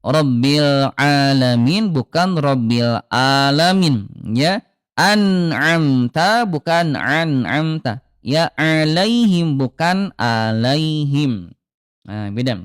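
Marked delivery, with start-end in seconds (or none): 0.0-4.0 "Rabbil alamin" first with ain, then with hamzah, slowly; slow contrast of "alamin". Shown as a demonstration. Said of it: "hati-hati ya". 4.9-11.5 slow recitation contrasting ain and hamzah. Shown as a demonstration. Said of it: "hati-hati ya".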